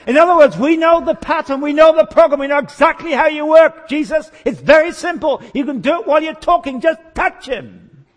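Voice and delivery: loud, high-pitched pleading with Jesus; hammed-up strident plea; insistent begging. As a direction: badger the Lord urgently at full voice. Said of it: "they begged him to touch him".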